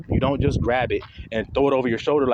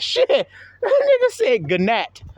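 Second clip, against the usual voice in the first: high, drawn-out words broken by laughter; laughing disbelief; slower than usual. Retold or imitated. Recalling classmate's misreading, cracking up. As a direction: crack up while saying it, pitched way up.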